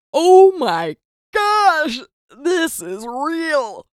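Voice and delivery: high, drawn-out stunned exclamation; oh-my-gosh moment, slow and soaring; awestruck freak-out. Retold or imitated. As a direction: stretch it out in high, loud disbelief. Panicked awe at a playback.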